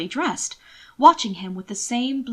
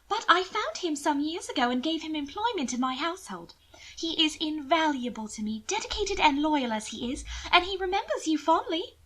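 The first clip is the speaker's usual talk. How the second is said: higher, pitying noblewoman; lightly raised lady's voice, sweetly condescending; Mina's voice; 7 semitones higher than usual. Acted. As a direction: coo over him with false pity. Narrator voices Mina.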